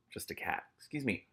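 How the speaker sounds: ordinary delivery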